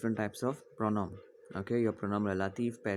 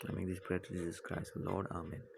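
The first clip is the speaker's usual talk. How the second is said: calm, slightly lowered prayer voice with long pauses; leading a prayer; 3 semitones lower, 5 dB quieter than usual. Real, not acted. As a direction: guide a class gently into silent prayer, growing softer to the Amen. Leads class in prayer.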